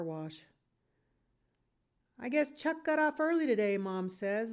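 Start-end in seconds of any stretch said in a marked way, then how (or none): 2.2-3.8 pleased. A narrator's reading. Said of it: "looking pleased".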